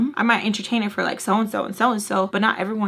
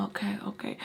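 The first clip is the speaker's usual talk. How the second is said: whisper; 13 dB quieter, faster than usual. Retold or imitated. Quietly giving in.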